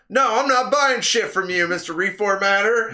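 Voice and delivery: loud, high indignant retort; retold anger. Telling off the man who reformatted the game, angry. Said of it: "I'm so pissed off".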